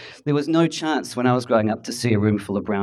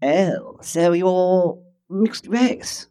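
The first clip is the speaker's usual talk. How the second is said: drawn-out, high establishment voice; patriarchal establishment voice; 6 semitones higher, much slower than usual. Retold or imitated. Establishment type meeting a mixed-race man, slow patriarchal tone. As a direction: imitate Adelaide establishment condescension, very slowly. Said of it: "this particular patriarchal, uh, tone of voice".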